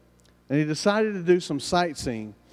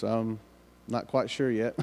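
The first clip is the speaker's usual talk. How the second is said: softer, lower, hesitant reply; sheepish uncertainty; 4 semitones lower, 5 dB quieter than usual. Retold or imitated. Frank answers curious coworkers, sheepish and low. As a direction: admit it quietly, a little embarrassed.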